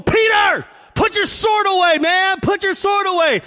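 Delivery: high, loud shout; urgent shout at Peter to drop the sword; yelling at Peter. Retold or imitated. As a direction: bark it at him to stop. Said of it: "Jesus is going".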